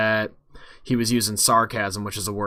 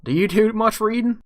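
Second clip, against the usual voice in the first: high-pitched, louder bartender question; raised barkeep voice, putting a question to a customer; 11 semitones higher, 5 dB louder than usual. Acted. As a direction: pipe up as a prying barkeep. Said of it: "the bartender is looking at you".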